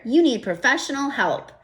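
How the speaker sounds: usual speaking voice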